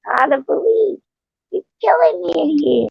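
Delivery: put-on a playful replay of a childhood cry for help; mock cry for help. Grown friend quoting the old line for fun, a high mock plea. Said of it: "I still say".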